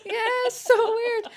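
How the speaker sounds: high giggly disbelief; laughing. Real, not acted. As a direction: laugh through the words. Laughing at foot fetish.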